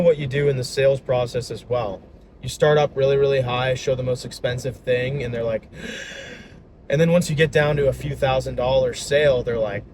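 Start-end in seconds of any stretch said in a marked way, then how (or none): 5.7-6.4 imitated customer's sharp gasp; shocked gasp. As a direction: gasp as if at a huge price. Shocked customers seeing expensive price.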